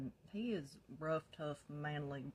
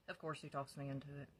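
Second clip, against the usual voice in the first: ordinary delivery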